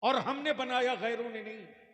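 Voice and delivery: loud and high, calming down by the end; preaching shout. Real, not acted. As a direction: hammer home who is to blame. Fiery sermon climax. (जोश में ऊँची आवाज़ से कहना)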